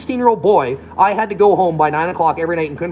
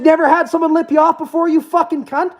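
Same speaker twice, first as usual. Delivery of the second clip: mock taunting yell; 12 semitones higher than usual. Retold shouting match, taunting.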